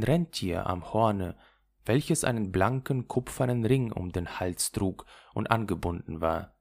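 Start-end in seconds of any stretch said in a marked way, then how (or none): none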